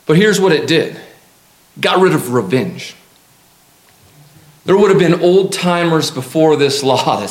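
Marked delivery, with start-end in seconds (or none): none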